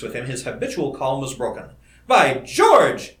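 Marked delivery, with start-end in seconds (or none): 2.1-3.2 loud, high exclamation of delight; amazed, joyful cry. Acted. Colleague finally grasping the theory, crying out in joy. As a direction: burst out, calm suddenly broken. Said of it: "he cried".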